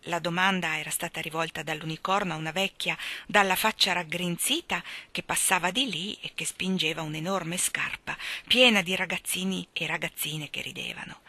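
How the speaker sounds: usual speaking voice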